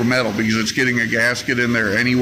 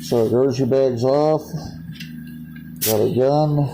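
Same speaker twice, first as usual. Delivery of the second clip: muffled through a respirator; talking behind a mask; 2 semitones higher than usual. Real, not acted. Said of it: "Got my respirator on".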